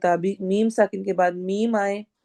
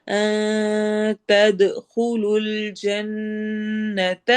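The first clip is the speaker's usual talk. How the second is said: slow melodic tajweed chant; measured Quranic recitation; much slower than usual. Shown as a demonstration. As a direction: recite slowly, stretching the long vowels. Recites verse phrase.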